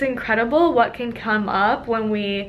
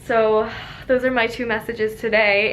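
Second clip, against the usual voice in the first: ordinary talk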